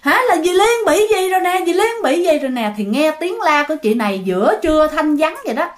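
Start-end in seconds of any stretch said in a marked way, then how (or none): 0.0-2.7 high-pitched mock cry for help; retold panicked call, voice pitched up; calling out in alarm. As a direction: call the neighbors over in alarm. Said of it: "chị này mới kêu lên".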